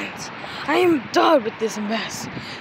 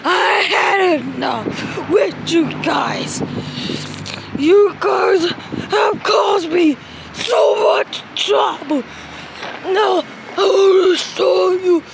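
angry shout; 5 semitones higher, slower than usual. Acted. Shouting at the guys.

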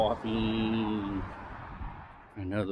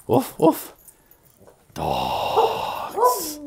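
high, playful woofing at the dogs; playful dog imitation; mock barking; 7 dB louder, much slower than usual. Real, not acted. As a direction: bark like a pup to the dogs.

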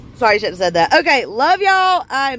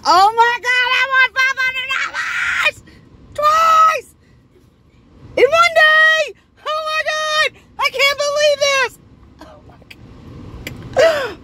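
ecstatic shouting; 9 semitones higher than usual. Real, not acted. To her viewers after winning twice, shrieking with joy. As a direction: scream with real joy. Second five hundred win.

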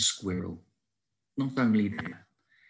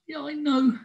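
higher, small squirrel voice; sheepish squirrel. A narrator's reading. Squirrel admitting to Howard he needed help, sheepish. Sorry squirrel answers friend.

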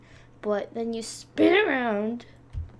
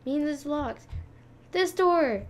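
usual speaking voice

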